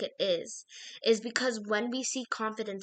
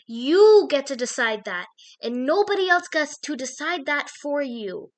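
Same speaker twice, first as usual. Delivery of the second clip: loud, rising, forceful pep talk; emphatic and loud; 5 semitones higher, 10 dB louder than usual. Real, not acted. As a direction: drive it home with force.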